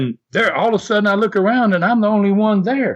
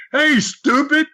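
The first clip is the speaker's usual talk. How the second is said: high-pitched retold yell, no real volume; mock scream; 9 semitones higher, slower than usual. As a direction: imitate an exasperated partner calling out. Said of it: "Dick Murdoch screams at me".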